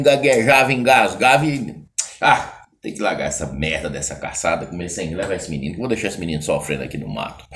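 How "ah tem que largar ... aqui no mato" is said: low, soft grumble under the breath; low mutter of a hunter annoyed at giving up the hunt; low grumbling mutter. Acted. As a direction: curse the hunt quietly to yourself.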